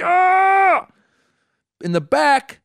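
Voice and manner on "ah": high, loud scream of frustration; acted inner scream. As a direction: scream as if bursting inside. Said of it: "Inside me, I'm like".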